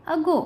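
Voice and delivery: अगो starting on a short अ. Shown as a demonstration. Teacher showing learners another sound of A, अगो with short अ. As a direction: open अगो with a short neutral अ. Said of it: "अ के रूप में भी".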